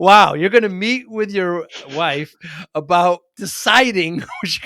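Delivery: loud, slow, amused and laughing. Real, not acted. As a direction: laugh your way through it. Amused by client's answer.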